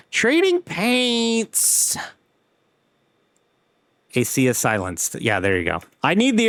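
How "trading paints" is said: exasperated call. Real, not acted. Software not running, calls out.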